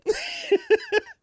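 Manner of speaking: very high short rising yelp; squeaky falling yelp. Shown as a demonstration. Actor demonstrating yet another fall scream. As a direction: yelp as you drop. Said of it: "it depends".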